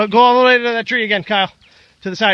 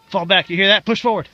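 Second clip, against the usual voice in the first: usual speaking voice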